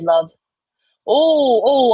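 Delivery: usual speaking voice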